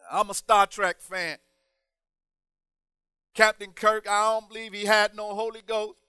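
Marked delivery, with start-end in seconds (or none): none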